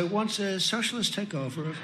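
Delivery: exaggeratedly restrained, hushed politeness; nice, toned down. Shown as a demonstration. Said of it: "trying to say that very nicely".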